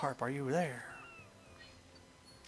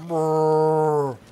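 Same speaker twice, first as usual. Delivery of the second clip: loud rolled creature rumble; imitated creature noise; 8 dB louder than usual. Player voices manatee creature, loud trilled noise. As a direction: trill a long loud brr.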